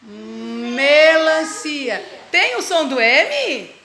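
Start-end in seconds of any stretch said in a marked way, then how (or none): 0.0-2.0 very slow syllables. Shown as a demonstration. Teacher sounding out slowly for the child. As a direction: draw melancia out syllable by syllable. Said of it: "Fala, Luquinha".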